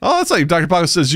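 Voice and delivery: normal talking voice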